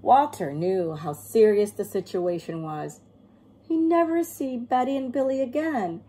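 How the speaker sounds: plain reading voice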